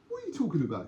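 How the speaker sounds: high-pitched mock challenge; acted disbelief. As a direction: play the baffled mate butting in. Acted mate challenges the deal.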